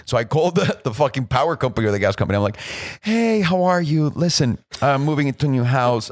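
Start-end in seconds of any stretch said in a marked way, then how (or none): 3.0-6.1 sweet phone voice. Retold or imitated. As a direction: lay on the nice-guy charm for the gas company. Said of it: "I am so fucking nice on the phone".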